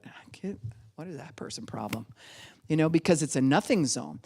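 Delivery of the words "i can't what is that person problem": confused whispered aside. Pretend. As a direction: whisper it as if puzzled by someone. Puzzled listener whispering aside.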